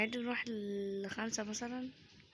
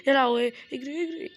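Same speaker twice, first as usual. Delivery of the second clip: loud frantic "اجري" while fleeing; panicked yell; 4 semitones higher, 7 dB louder than usual. Real, not acted. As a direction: shout it, running scared. Granny chasing him. (صريخ ذعر وهو بيجري)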